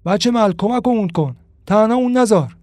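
loud call for help across a crowd; yelling for help from the crowd; shouted plea. Acted. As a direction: shout it out to the neighbour boy. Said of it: "فریاد زد".

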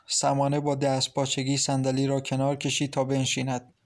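usual speaking voice

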